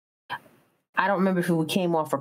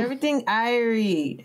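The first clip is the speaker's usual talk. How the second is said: raised, easygoing island lilt; island lady; 4 semitones higher than usual. Retold or imitated. Island lady reassures impatient tourists, carefree lilt. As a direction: imitate a carefree Jamaican woman. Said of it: "she was like".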